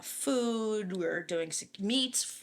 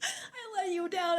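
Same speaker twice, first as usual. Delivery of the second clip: much higher and slow, a hammed-up whimper; mock crying; 11 semitones higher, slower than usual. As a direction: pretend to cry over letting everyone down. Mock despair after being scolded.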